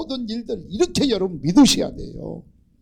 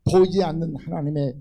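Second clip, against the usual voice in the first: usual speaking voice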